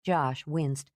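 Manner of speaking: plain reading voice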